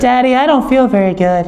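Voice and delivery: put-on high, whiny child voice; a little girl feeling sick at night; high plaintive child voice.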